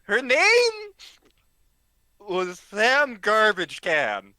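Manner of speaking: high then slow, drawn-out name; proudly spelling out her silly name, slowly; drunk announcement. Retold or imitated. Drunk guy naming girl.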